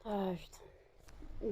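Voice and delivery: mid-yawn whispered curse. Real, not acted. Owner yawning mid-vlog, whispered curse. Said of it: "j'arrête encore pas de bailler".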